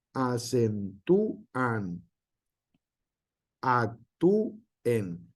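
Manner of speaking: Acentúan and Actúen said very slowly, ú stressed and split off; slow demo. Shown as a demonstration. Teacher spelling out example words to students, slowly. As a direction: sound out each word slowly. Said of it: "listing hiatus example words".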